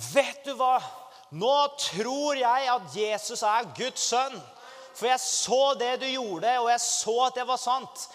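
a new believer telling the preacher, excited; excited young woman. Retold or imitated. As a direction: burst out with happy conviction. Woman voiced, overjoyed at conversion.

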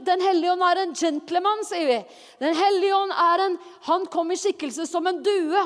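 ordinary talk, nothing marked

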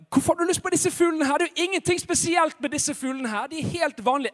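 high-pitched, hurried disbelief; baffled boy. Acted. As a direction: question it like a puzzled youngster. Boy baffled by pastor's request. (forundret, lys guttestemme)

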